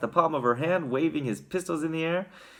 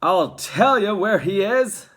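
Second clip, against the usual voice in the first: loud, slow, raised voice; higher, drawn-out fatherly outburst; exasperated father; 7 semitones higher, 8 dB louder, slower than usual. A narrator's reading. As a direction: announce it with heavy exasperation. Father answers frantic mother.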